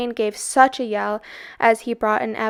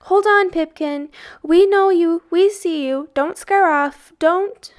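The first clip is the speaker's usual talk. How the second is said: higher, louder pleading shout; pleading call; 7 semitones higher, 7 dB louder than usual. A narrator's reading. Tom calls after the fleeing dog, pleading loud. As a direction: call him back, begging. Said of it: "Tom whistled".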